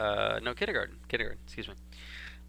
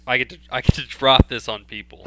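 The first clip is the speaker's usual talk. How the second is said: eager, louder as it goes; bursting to share a secret; excited kid; 8 dB louder, faster than usual. Retold or imitated. Boy telling himself his big news, eager and loud. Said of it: "I was so excited".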